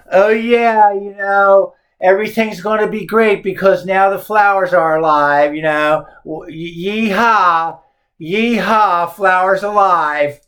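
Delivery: a high, sarcastic put-on voice, lightly done; mocking interpreter. Teacher mocking a web interpreter's sneering tone of Frost. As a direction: send up the poem with fake cheer. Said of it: "making fun of it".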